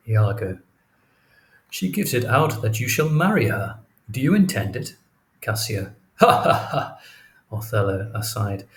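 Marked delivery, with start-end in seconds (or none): 6.2-7.0 high breathy scoffing laugh; mocking laugh. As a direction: laugh off the very idea. Cassio laughs off marriage.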